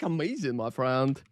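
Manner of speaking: ordinary talk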